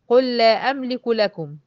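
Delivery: قل لا as one held stressed lam; slow, loud "qul-la amliku lakum"; doubled lam. Shown as a demonstration. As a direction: merge both lams into one doubled lam. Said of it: "اقول لام واحدة مشددة".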